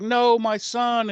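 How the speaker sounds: high, slow, hammed-up plea for her son; mock pleading. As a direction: wail it like a mock-desperate mother. Maid begs for her child.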